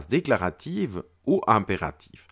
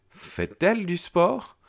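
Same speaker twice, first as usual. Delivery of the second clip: "Fait-elle du sport?" pitched much higher and slower, rising on "sport"; questioning rise; 9 semitones higher, slower than usual. Shown as a demonstration. Teacher demonstrating the inverted question, high and slow. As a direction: lift the whole question high and draw it out. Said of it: "je change également l'intonation de ma voix".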